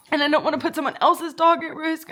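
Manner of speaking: usual speaking voice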